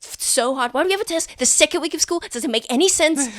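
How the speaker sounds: student griping about a surprise test; whiny student rant. Acted. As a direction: build a fast, high-pitched schoolkid gripe. Student ranting about the maths test.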